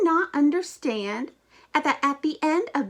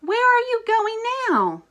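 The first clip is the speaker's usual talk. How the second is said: surprised call; 10 semitones higher, 3 dB louder, faster than usual. Acted. Elmo runs off again.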